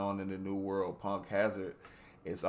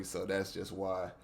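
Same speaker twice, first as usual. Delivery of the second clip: normal talking voice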